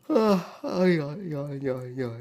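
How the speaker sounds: high excited yeah yeah; excited yelps. Real, not acted. High sudden outburst while playing.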